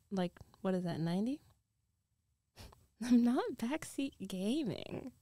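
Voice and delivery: ordinary delivery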